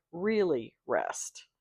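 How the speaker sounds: slow, hushed whisper. Retold or imitated. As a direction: breathe it out softly and slowly. Quoting director's emphatic advice.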